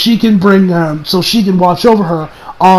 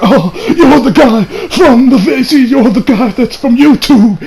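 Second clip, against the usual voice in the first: higher, slow drawn-out recognition; hammed-up amazed bystander; mock astonishment; 6 semitones higher, slower than usual. Clerk recognises the YouTube villain, mock astonishment.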